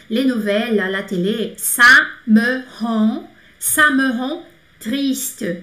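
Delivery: very slow "ça me rend triste", drawn out; slow model sentence. Shown as a demonstration. Teacher modelling a French sentence slowly. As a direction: stretch each word for learners. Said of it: "Então".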